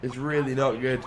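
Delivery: ordinary delivery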